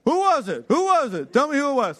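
loud, high, insistent questioning; angry demand. Retold or imitated. Dad in the principal's office demanding the teacher's name, angry and loud. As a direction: press hard for the name.

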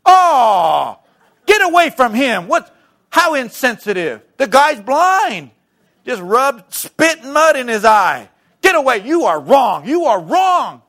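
high, loud mock outrage; hammed-up indignant shouting, drawing out key words; outraged onlookers. Christians scolding Jesus over the blind man, mock outrage. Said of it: "you know what they'd say".

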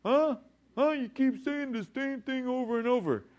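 high, exasperated mock protest; high-pitched, put-upon challenge; indignant complaint. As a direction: whine back as an acted listener who feels insulted. Mock complaint voiced to Solomon.

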